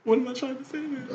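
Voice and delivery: high, soft fake sobbing voice; mock crying. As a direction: pretend to cry through the words. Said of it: "I'm tired of crying".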